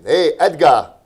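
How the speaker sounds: put-on playful Boston accent greeting. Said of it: "You like my Boston accent?".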